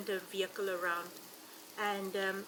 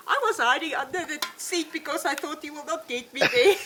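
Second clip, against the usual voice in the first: higher, louder, breaking into laughter; amused retelling; 6 semitones higher, 11 dB louder than usual. Retold or imitated. Guide quoting a little boy after the elephant charge, amused and laughing. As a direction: quote a child while starting to laugh.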